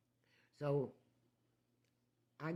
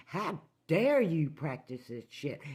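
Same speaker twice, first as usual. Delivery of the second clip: loud, higher, angry challenge; furious retelling; 4 semitones higher, 7 dB louder than usual. Retold or imitated. Wife confronting husband over the barn, angry shout. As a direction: fling the accusation at him. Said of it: "I was furious".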